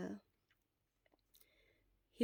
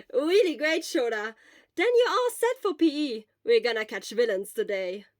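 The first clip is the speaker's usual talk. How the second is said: excited; 3 semitones higher, 9 dB louder than usual. Acted. Obo cheering Shura on before class, excited. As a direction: burst out happily.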